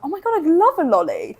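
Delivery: high-pitched mock excitement; acted delight. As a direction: play up being thrilled at an offer. Retells delight at being offered lolly.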